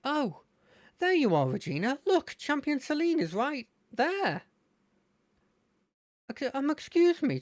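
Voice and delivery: high-pitched, excited lad; eager fan in a high voice; Terry. Acted. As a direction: start on a high "Oh", stay swooping. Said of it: "to get me into his voice".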